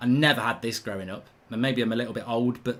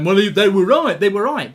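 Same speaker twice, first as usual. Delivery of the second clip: louder, higher jeering voice of gloating rival fans; mock know-it-all fans; 5 semitones higher, 10 dB louder than usual. Mimicking rival fans gloating at him, louder and higher. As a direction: gloat like the fans who think they're right. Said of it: "And you'll all be going".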